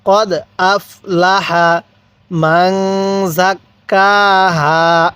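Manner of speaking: কদ আফলাহা read slowly with জাক্কাহা's doubled k held; slow recitation demo. Shown as a demonstration. Teacher demonstrates the verse slowly for learners. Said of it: "হ্যাঁ, পড়ুন".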